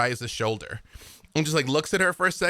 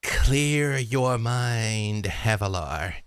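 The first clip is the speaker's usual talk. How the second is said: slow, drawn-out gentle urging; a hint of a guiding creature; 4 semitones lower, much slower than usual. Hollyphant to Havilar, slow coaxing words. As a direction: coax her very slowly.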